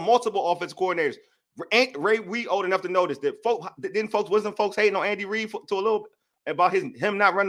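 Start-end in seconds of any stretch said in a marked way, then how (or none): none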